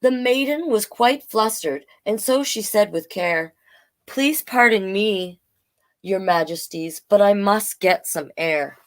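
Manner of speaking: storybook read-aloud in rhyme; story narration. A narrator's reading. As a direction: tell it gently like a bedtime tale.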